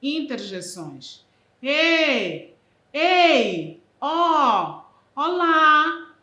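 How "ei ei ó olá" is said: loud, high calls across a distance; loud high hailing calls; calling out. Shown as a demonstration. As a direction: call out to someone far away.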